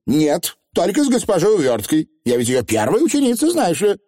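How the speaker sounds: put-on high-pitched, boastful young witch; proud, self-important declaration. A narrator's reading. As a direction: show off your standing, pitched well up. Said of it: "с гордостью заявила анаграмма".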